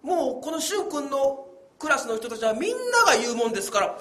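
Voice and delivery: ordinary talk, nothing marked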